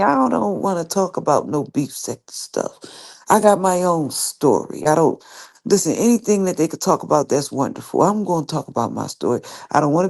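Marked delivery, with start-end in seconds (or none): none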